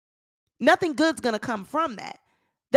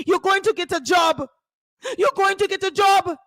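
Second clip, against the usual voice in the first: mock scolding husband; 5 semitones higher than usual. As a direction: yell at the wife in panic. Acting Tasha's husband scolding.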